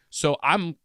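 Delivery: normal talking voice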